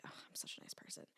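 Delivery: smug whispered aside. Retold or imitated. Self-praise whispered to herself while helping an old lady. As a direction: whisper it to yourself, pleased.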